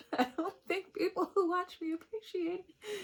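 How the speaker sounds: high, tearful, half-laughing; a playful crybaby whine; teary. Real, not acted. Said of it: "feel like crying".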